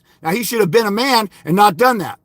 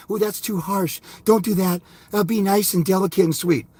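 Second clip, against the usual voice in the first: softer, dainty mock of a critic; mocking prissy critic; 3 semitones lower, 5 dB quieter than usual. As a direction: coo it like a prissy scold. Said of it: "bitch-made little Chris Bacon's come along".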